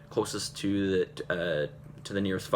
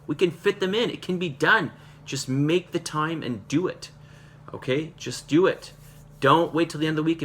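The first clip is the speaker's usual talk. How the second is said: raised, higher-pitched coach's urging; emphatic, fired-up motivational push; urgent pep talk; 7 semitones higher, 5 dB louder than usual. Real, not acted. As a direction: push them hard, punching each command. Coach exhorting group to train.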